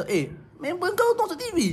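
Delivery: a high, lightly mimicked mum; imitating his mum. Mum telling him his friend is on TV, lightly mimicked. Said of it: "Ah, dia".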